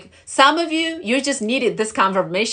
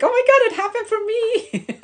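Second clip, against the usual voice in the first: very high, giddy mock squeal; high-pitched reenacted thrill; excited, scared squeal; 4 dB louder than usual. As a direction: squeal it out, tipping into laughter. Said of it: "you get so excited".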